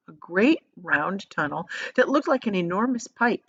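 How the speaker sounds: ordinary delivery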